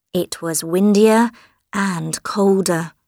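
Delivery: ordinary delivery, nothing marked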